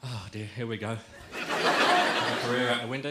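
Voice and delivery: ordinary delivery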